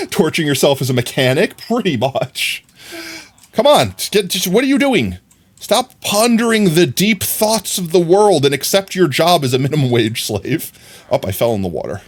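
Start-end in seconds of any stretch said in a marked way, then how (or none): 3.5-9.4 exasperated. Real, not acted. Exasperated at idle villager.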